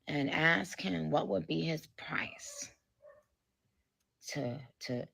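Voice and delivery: 1 whisper. Real, not acted.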